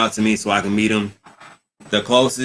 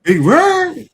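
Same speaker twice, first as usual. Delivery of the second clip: much higher, louder announcer shout of the name; hype call; 8 dB louder than usual. Acted. Host greeting Vern as he returns, booming hype call. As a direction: boom his name out to the crowd.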